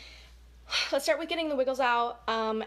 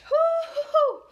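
loud, high laughing woo-hoo; high laughing whoop; 8 dB louder, slower than usual. Real, not acted. Celebrating the end of the wiggles, laughing whoop. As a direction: whoop with joy after the count.

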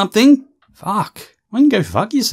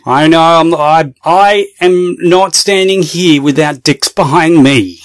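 loud, insistent protest; hammed-up loud indignation; worked-up shouting; 6 dB louder than usual. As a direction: argue back at full volume. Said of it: "Just calm the fuck down".

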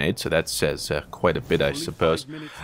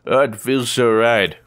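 ordinary talk, nothing marked

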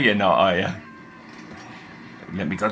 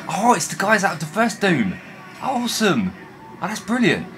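excited; 10 semitones higher, 3 dB louder than usual. Real, not acted. Recognising old Doom enemies.

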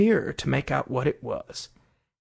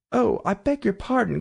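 Alice's higher, mildly flustered cry; hasty apology; 6 semitones higher than usual. A narrator's reading. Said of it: "cried Alice hastily".

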